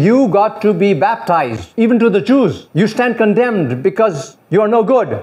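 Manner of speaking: retold prophet's rebuke. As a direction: thunder like John the Baptist, but only half in earnest.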